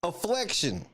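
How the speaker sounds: "Afflection" with an L slipped in after the F; added L sound. Shown as a demonstration. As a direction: repeat "Afflection", keeping the extra L. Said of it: "afflection with an L".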